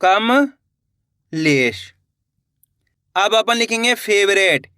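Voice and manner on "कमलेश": कमलेश drawn out very slowly, syllables joined; slow teacher blending; slow blended word. Shown as a demonstration. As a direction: stretch कमलेश out as the letters combine. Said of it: "तो मिलके बन गया अपने".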